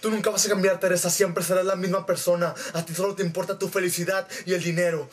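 low, reproachful man's voice; Arturo. Retold or imitated. Arturo rejects Teresa's promise, low and stern. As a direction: lay down the verdict in a put-on low voice. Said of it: "y Arturo le dijo".

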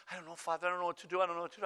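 flustered, repetitive pleading; frantic nun. Retold or imitated. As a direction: play a nun at her wits' end. Distressed nun seeks help.

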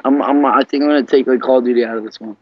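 ordinary delivery

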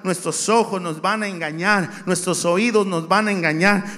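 loud, rising preacher cadence; fiery sermon delivery; preaching. Acted. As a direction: preach it to the congregation with force. Preaching at full force.

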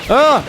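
short high yelp of fright; a sudden cry as something jumps out; sharp startled shout. Real, not acted. Sudden attack in game.

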